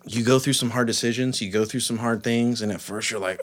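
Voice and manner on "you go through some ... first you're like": usual speaking voice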